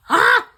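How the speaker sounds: sharp high loud cry of pain; a stabbed-in-the-heart shout; anguished cry. Real, not acted. Host hit by the saddest line, loud pained cry. Reacting to Mama's gone line.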